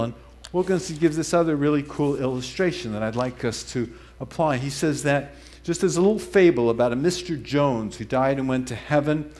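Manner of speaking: ordinary delivery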